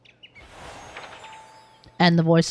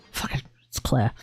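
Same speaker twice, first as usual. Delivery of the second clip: ordinary delivery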